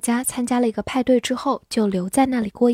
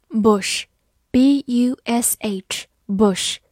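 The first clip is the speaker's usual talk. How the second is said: slow spelling; much slower than usual. Shown as a demonstration. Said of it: "spelling the word".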